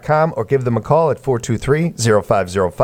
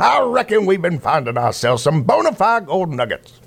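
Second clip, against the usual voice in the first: southern prospector; 6 semitones higher than usual. Pretend. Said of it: "it gets a little southern towards the end there".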